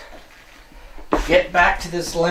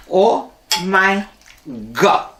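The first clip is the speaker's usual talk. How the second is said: a light imitation of his mother-in-law's accent, a bit higher and louder; mother-in-law's Oh my God; 3 semitones higher, 3 dB louder, much slower than usual. Vlogger copying how his mother-in-law exclaims, lightly accented. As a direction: mimic her cute little accent, lightly. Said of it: "That's the way my mother-in-law says it".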